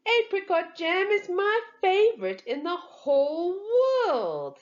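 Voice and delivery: put-on high, bouncy little-girl voice; little sister gushing to Papa about her favourite jam; excited child. A narrator's reading. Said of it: "hopping up and down".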